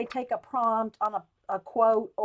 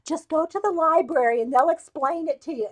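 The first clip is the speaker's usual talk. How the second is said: ordinary talk, nothing marked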